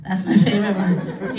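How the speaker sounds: ordinary talk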